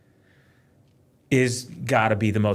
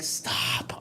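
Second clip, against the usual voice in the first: voiceless, soft cut-off; hushed reprimand; 8 dB quieter than usual. Retold or imitated. Dad answering a daughter who refuses, hushed reprimand. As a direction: whisper it to shut down the protest.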